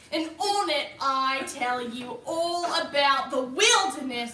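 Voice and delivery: put-on Australian wildlife-show host accent; Aussie accent. Host introduces her wildlife show, Australian accent. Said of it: "Mom really wants to hear your Aussie accent".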